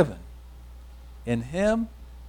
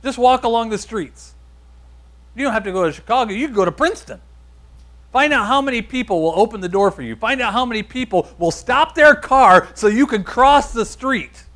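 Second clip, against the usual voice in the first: loud, higher-pitched challenge from the pulpit; impassioned preaching; 5 semitones higher, 7 dB louder than usual. Real, not acted. Preacher challenging the congregation to go look for acceptance, impassioned. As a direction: preach it loud, punching key words.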